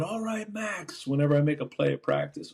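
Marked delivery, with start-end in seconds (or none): 0.0-1.1 high mock yell, held back in volume; pitched-up, softened shout; retold yell. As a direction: imitate a coach hollering, without the volume. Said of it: "he's yelling".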